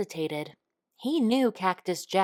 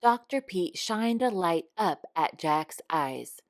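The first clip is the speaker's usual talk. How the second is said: ordinary delivery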